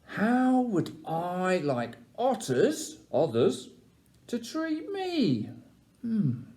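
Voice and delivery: slow, wondering question; pondering. Acted. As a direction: muse it over slowly. Rabbit ponders aloud.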